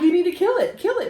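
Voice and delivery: high, loud mock-frantic demand; acted-out panic, insistent and high; retold hysterical screaming. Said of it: "I'm screaming".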